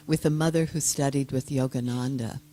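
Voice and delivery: normal talking voice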